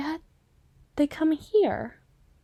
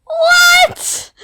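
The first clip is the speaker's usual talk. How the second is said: high, loud startled shout; shocked shout; 12 dB louder than usual. Acted. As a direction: blurt it out in total disbelief. Shocked outburst at blunt remark.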